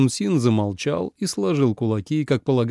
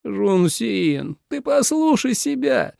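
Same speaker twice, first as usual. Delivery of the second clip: high-pitched exasperated plea; 10 semitones higher, slower than usual. A narrator's reading. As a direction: plead in disbelief, voice climbing high. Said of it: "закатил глаза Минлу". (раздражённо, на высоких нотах)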